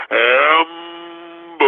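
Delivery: long drawn-out mock drone; mocking taunt. Shown as a demonstration. Host shows how to heckle scooter riders, drawn-out taunt. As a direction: stretch both syllables out in a taunt. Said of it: "pass by 'em and say".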